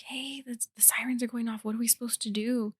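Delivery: hushed, breathy warning at the door; whisper. Retold or imitated. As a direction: whisper it so the kids stay asleep. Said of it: "and she's like".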